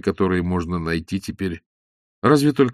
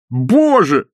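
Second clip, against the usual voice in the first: high, loud "Боже!" with falling tune; old man's exclamation; 8 semitones higher, 6 dB louder than usual. A narrator's reading. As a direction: say "Боже!" in awe. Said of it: "произнёс старик".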